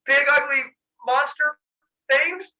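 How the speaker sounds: high, loud, slow address; calling out. Retold or imitated. Zeus addressing the ugly monsters down in the pit, loud slow call. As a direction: hail them word by word with pauses.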